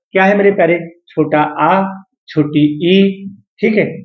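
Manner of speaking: usual speaking voice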